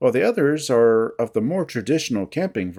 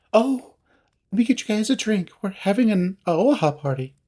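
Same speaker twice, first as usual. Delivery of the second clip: high, bubbly hostess chatter; excited child; 6 semitones higher than usual. A narrator's reading. Feline offers guests drinks at her party, childlike excitement. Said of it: "her demeanor like an excited child".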